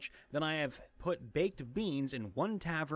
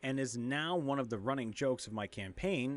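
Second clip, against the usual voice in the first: normal talking voice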